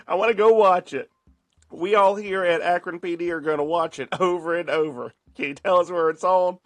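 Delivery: high, loud, laughing put-on cop voice; laughing mock dispatcher. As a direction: play the dispatcher while cracking up. Mocking the dispatcher on the call.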